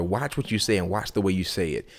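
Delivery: usual speaking voice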